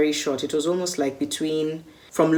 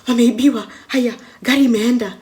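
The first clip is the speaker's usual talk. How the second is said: higher, louder panicked cry of bad news; alarmed outcry; 8 semitones higher, 5 dB louder than usual. Father rushing in to tell guests of robbery, panicked shout. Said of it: "he runs into the home saying".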